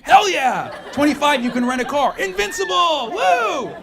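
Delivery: rising party cheer, ending in a whoop; young adult getting wilder each birthday; building cheer. Acted. Escalating cheering of rights.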